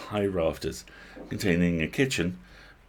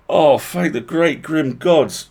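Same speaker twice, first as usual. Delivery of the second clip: relieved exclamation; 8 semitones higher, 10 dB louder, slower than usual. Acted. Nasilka relieved.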